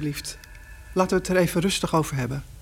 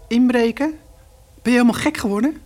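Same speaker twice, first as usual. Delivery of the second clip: higher, louder disbelief; angry scolding; 5 semitones higher, 3 dB louder than usual. Acted. Mother scolds her son for breaking in, angry. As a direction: tell him off sharply.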